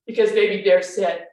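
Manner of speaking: plain reading voice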